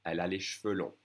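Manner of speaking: cheveux squeezed to one syllable "shveu". Shown as a demonstration. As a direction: say cheveux without its first E. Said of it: "I'm gonna drop that E".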